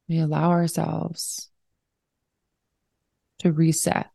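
unhurried, even-toned breathing cues; guided meditation. Real, not acted. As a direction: guide them into slowing down, leaving room to breathe.

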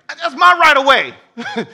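high, loud, slow indignant claim; hammed-up driver insisting on his rights; mock road rage. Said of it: "we're driving".

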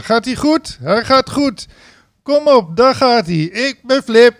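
high, loud crowd-pumping host; hyped-up announcer; hyped ringmaster. Retold or imitated. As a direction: fire up the crowd like a show host. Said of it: "ringmaster hyping the crowd".

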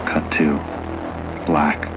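usual speaking voice

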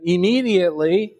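immediately lifted high, rising at the end; stress on immediately. Shown as a demonstration. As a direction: lean on immediately to point it out. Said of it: "there's that word again".

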